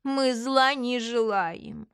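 very slow, drawn-out pious lament; plaintive, dragged-out forgiveness; old woman martyr. Acted. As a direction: play the long-suffering old lady. Old swindler plays forgiving martyr.

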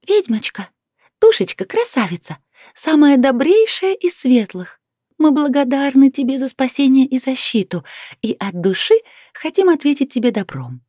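put-on high, solemn chirping old fairy lady. A narrator's reading. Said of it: "торжественно прощебетала пожилая дама".

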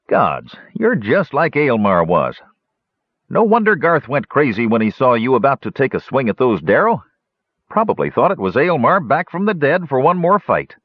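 a hint of a laughing old host reminiscing; innkeeper Muldoon. Muldoon voiced by narrator.

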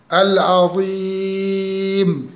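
very slow العظيم, long i held at length; chanted العظيم with an extended level ee; longest madd. Shown as a demonstration. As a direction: stretch the ee of العظيم to its fullest. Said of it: "أو أربعة أو ستة".

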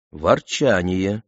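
plain reading voice

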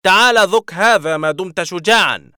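high, loud alien war cry; brandishing a laser sword, yelling a challenge; menacing shout. A narrator's reading. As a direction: dare him to come fight. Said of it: "وصاح".

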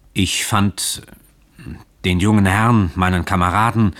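usual speaking voice

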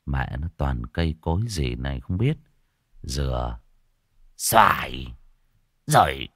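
low-pitched grumble with pauses; grumbling ruffian. Acted. Gang member looking at strange trees, grumbling low.

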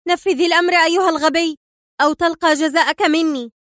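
high-pitched furious order; angry command. A narrator's reading. Sonia ordering hesitant Frank about, angry. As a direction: threaten him into obeying at once. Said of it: "صرخت سونيا في غضب".

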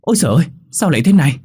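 high, loud, fast shocked outcry; cry out. Acted. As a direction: blurt it out in sudden horror. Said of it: "Thằng Tam bỗng kêu lên". (thằng Tam thấy xác, kêu lên thảng thốt)

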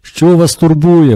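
slow, loud, high shout into a deaf ear. A narrator's reading. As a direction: bellow each word so she can hear. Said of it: "подібним чином крикнув туди Тарас".

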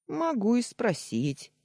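high, slow, deliberate reply; teasing concession. A narrator's reading. Daniil replying to the heroine's accusation, teasing concession. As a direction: draw it out as a challenge.

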